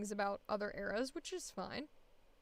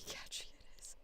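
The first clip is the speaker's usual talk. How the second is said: laughing whisper; 15 dB quieter than usual. Real, not acted. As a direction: whisper it in exasperation.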